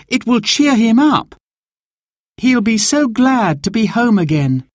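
put-on high, happy child's voice; delighted child. A narrator's reading. Girl admires banner made for Dad, delighted, high. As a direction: gush like a pleased little girl.

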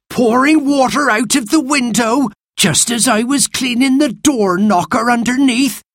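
high, indignant character voice; outraged nursemaid's high-pitched rant; scolding. A narrator's reading. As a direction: complain crossly at naughty children. Martha scolds children over water.

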